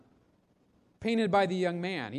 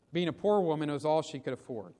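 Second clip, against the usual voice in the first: normal talking voice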